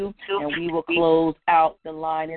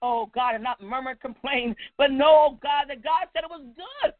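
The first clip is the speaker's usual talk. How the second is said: higher-pitched, urgent prayer cadence; impassioned prayer; 6 semitones higher than usual. Real, not acted. Preacher surrendering to God before the congregation, impassioned prayer. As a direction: cry out to God in swelling phrases.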